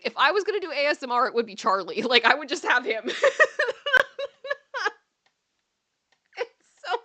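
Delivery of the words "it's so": high, laughing through the words; helpless laughter breaking up the line; cracking up. Real, not acted. Cracking up over the rabbit as ASMR, laughing hard.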